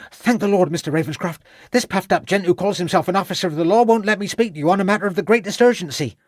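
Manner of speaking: high-pitched, fast, indignant servant lad; indignant youth. A narrator's reading. Young servant held by the collar complains to Ravenscroft, indignant. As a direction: blurt out an outraged complaint in a high boyish voice. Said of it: "said an indignant Stebbins".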